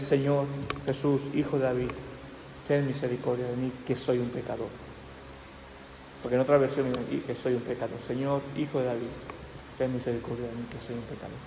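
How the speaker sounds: low, hushed, humble plea; heartfelt prayer. Shown as a demonstration. Preacher showing a congregation how to pray from the heart, hushed and humble. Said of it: "sino que sea de corazón".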